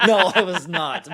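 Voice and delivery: laughing denial. Real, not acted. Laughing denial of drunk race.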